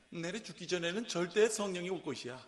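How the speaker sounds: playful North Korean dialect, rising loud; hammed-up North Korean accent, laughing. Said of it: "북한 사투리로 해볼까요?".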